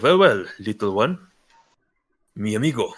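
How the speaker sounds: lightly voiced Spanish accent, amused; a hint of a Spanish-accented stranger greeting kindly. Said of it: "a Spanish accent behind you".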